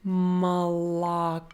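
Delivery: "молоко" drawn out slowly as muh-la-KO; slow teacher's "молоко", stress on the end; three different O sounds. Shown as a demonstration. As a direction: model "молоко" slowly, only the last O full. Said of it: "all of them will sound differently".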